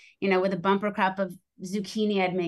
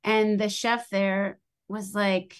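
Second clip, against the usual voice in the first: usual speaking voice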